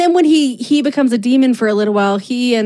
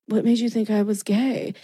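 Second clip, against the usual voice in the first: sly; 7 dB quieter than usual. Retold or imitated. Dean quietly fishing with the clerk, sly and hushed. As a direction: slip the question in slyly. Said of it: "like, very sly".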